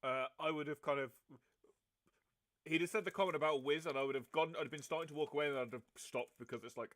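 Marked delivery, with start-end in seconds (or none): none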